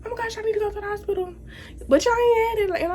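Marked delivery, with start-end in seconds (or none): 0.1-1.3 high, fast, thin panic; breathless high-pitched panic; mock panicked self. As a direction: squeal it in a rush like your water just broke. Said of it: "I'm like".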